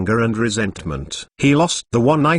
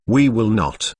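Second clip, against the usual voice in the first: ordinary delivery, nothing marked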